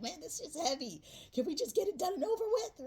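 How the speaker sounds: high, loud, fast mock complaint; exasperated viewer. Reader voicing the weary viewer, high exasperated whine. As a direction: play the fed-up viewer begging to be done. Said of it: "You're like".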